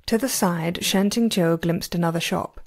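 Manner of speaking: usual speaking voice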